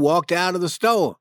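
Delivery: ordinary delivery, nothing marked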